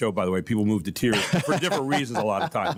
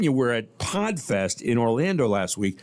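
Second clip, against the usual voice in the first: ordinary delivery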